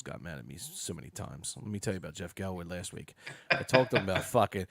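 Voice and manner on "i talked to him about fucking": laughing. Real, not acted. Laughs through his own story.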